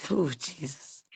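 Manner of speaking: low, hushed plea to Jesus; holding back tears. Real, not acted. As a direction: sigh the name out softly, close to tears. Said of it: "it makes me wanna cry".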